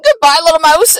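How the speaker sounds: high, loud hurried goodbye; panicked farewell. Acted. Owl flees the Gruffalo, high panicked farewell. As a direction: squawk goodbye while fleeing.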